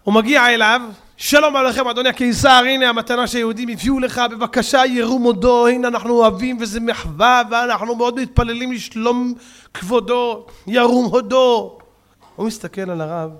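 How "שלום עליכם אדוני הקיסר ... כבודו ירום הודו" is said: formal courtly address. Acted. Rabbi formally addressing emperor.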